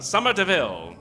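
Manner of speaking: usual speaking voice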